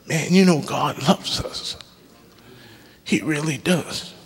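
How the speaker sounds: softly, real feeling catching him; hushed and moved, voice dropping away; emotional. Real, not acted. Preacher to congregation about God's love, softly emotional. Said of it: "I'm getting emotional".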